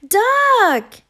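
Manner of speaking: high, loud happy cry of the name; glad shout. A narrator's reading. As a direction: call out, thrilled to be found. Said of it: "answered Goose".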